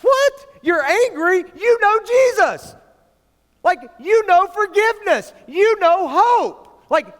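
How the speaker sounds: high, loud, disbelieving preacher; fired-up pastor scolding angry believers, loud and high; incredulous preaching. Acted. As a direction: call them out, can't believe it. Preaching at full force.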